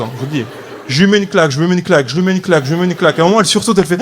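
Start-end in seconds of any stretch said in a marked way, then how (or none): none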